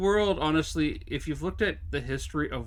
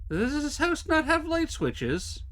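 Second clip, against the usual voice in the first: exasperated high; 11 semitones higher, slower than usual. Real, not acted. Exasperated in dark house.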